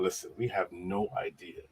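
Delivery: ordinary delivery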